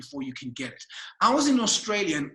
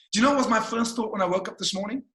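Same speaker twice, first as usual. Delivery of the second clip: ordinary talk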